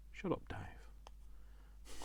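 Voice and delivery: slow whisper of shut up, Dave; whispered aside. Pretend. [name] jokingly hushing Dave, slow whisper. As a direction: hush yourself with a teasing whisper.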